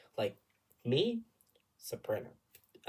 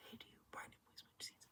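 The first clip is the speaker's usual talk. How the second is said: quick, barely audible whisper; choir whisper; 25 dB quieter, faster than usual. Retold or imitated. Singer asking neighbour to switch seats, fast whisper. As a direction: whisper a discreet request to your neighbour.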